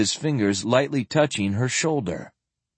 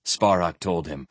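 ordinary delivery, nothing marked

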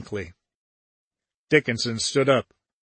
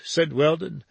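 ordinary delivery, nothing marked

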